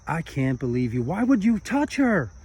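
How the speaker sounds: rising into a high, loud accusation; monk finally snaps at his friend, high and loud; accusing outburst. Acted. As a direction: let it burst out after hours of silence. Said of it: "He looks over at him and he says".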